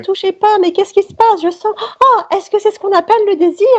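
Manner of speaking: high, fast, hammed-up swooning; breathless swooning heroine. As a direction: gasp it like a romance heroine. Acts the coy virgin heroine.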